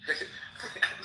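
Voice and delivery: short breathy laugh; a brief, quiet chuckle to oneself; amused chuckle. Real, not acted. Debater amused by opponent's attempt at a Farsi insult, short chuckle.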